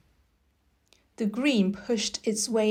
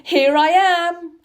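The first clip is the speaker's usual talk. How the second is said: louder, slow and higher: Here I am; proud announcement; 7 semitones higher, 8 dB louder than usual. Pretend. Boy imagining greeting his father, slow proud announcement. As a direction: plant your feet and announce yourself. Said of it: "walk into his study and say".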